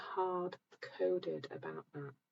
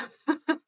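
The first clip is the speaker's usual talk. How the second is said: a sudden loud, high laugh; burst of laughter; 10 semitones higher, 10 dB louder than usual. Real, not acted. Host laughing at a viewer's comment, loud burst of laughter.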